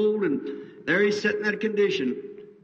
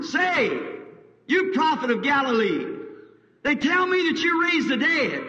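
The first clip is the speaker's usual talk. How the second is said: mocking holler; 8 semitones higher than usual. Mocking crowd hollers at the prophet from Galilee. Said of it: "Some of them hollering".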